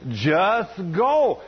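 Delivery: repeated divine command, lightly voiced; a hint of heavenly command; booming voice of God. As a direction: give a mild imitation of a booming voice. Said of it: "I heard this booming voice".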